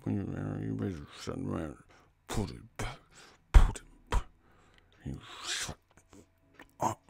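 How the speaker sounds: low-volume threat through pursed lips; puckered-mouth angry mom. Retold or imitated. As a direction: imitate mom scolding under her breath. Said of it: "Doing that asshole mouth and shit".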